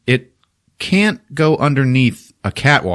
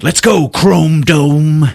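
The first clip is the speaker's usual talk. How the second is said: slow, louder drawn-out taunt; cocky taunt; 6 semitones higher, 6 dB louder, much slower than usual. Retold or imitated. Finn taunts Phasma before the fight, slow cocky dare. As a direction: challenge her with swagger. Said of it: "he's like".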